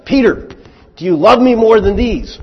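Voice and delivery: high, loud searching question; Jesus questioning Peter. Acted. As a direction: press him hard, calling out his name. Jesus confronting Peter, voiced.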